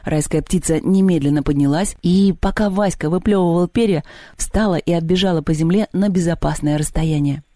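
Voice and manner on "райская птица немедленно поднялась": ordinary delivery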